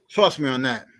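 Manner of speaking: ordinary talk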